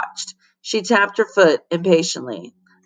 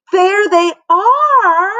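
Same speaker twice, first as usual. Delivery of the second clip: high, slow, louder coo; 12 semitones higher, 6 dB louder, much slower than usual. Acted. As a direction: coo it out sweetly and slowly. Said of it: "cooed a voice from the doorway".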